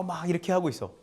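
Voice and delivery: ordinary delivery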